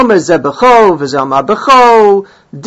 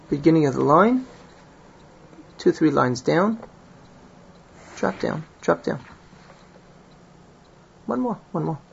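quiet aside; 10 dB quieter than usual. Real, not acted. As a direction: murmur place-finding directions to someone nearby. Aside about finding the place.

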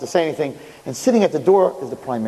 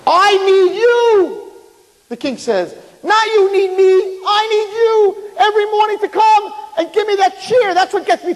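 high, loud, slow and drawn out; emphatic plea; 4 dB louder, slower than usual. Acted. King telling the little boy he needs his morning cheer. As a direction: stretch it out, pleading with feeling.

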